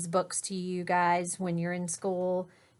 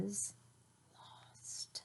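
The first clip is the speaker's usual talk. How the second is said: whisper; 21 dB quieter than usual. A narrator's reading. Dramatic whispered reveal.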